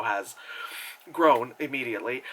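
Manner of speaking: usual speaking voice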